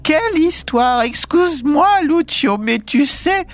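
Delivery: high giggly squeal; squealing with laughter. A narrator's reading. Wife finding her husband alive, squealing with laughter. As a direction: pipe it up high, bursting with hilarity. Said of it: "piaillait-elle en pouffant de rire".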